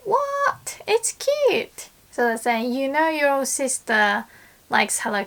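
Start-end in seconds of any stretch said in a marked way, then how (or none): 0.1-1.9 much higher, incredulous What?; indignant disbelief, pitched up high; surprised protest. Shown as a demonstration. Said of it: "and I wanna say".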